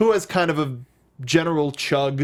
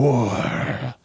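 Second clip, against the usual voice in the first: gruff sexy voice; 4 semitones lower than usual. Pretend. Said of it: "a gruff yet sexy voice shouting "War".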